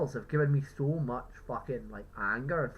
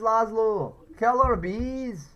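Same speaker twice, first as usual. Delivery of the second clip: louder, higher, slowed drawn-out yell; slow, stretched, higher caller yell; frantic radio caller shout; 8 semitones higher, 8 dB louder, much slower than usual. Retold or imitated. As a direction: stretch the killer bees into a frantic wail. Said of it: "one of the callers ... talking about killer bees".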